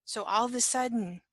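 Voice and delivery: ordinary delivery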